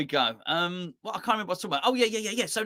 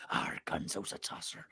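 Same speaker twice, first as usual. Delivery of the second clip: hushed, whispered repeat; under the breath; 9 semitones lower, 12 dB quieter than usual. Retold or imitated. Host won't repeat what he said, then whispers it. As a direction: slip it out in a whisper. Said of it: "I won't repeat what he said".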